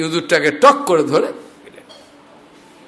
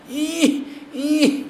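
straining grunt; 9 semitones higher, 6 dB quieter than usual. Retold or imitated. Straining to lift mountain.

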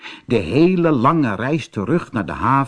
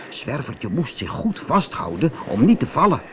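ordinary delivery

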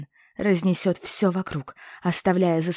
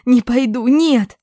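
high, loud panicked yell; 6 semitones higher, 11 dB louder, slower than usual. A narrator's reading. Captive girl screams at the witches, high and panicked. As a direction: scream the refusal in terror. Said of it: "Зачем так орать?".

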